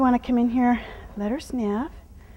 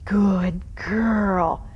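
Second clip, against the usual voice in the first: whispered praise; 4 semitones lower, 3 dB louder than usual. Real, not acted. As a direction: breathe the praise to calm her. Soothing praise to the horse.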